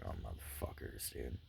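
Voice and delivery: breathy curse, almost no voice; barely audible curse. Retold or imitated. Alone with unanswered calls, he curses almost silently. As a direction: grumble it to yourself, nearly silent. Homies not answering their phones.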